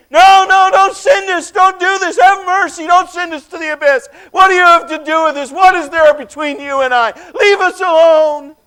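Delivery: high, loud, frantic pleading; panicked screaming. Acted. Demons plead with the stranger in terrified shouts. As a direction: demons begging not to be cast away.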